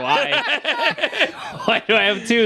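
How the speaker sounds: high laughing fake alarm; mock panic. Retelling fear of having two birds, high laughing alarm. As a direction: yelp it like you're in trouble. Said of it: "thought it was illegal".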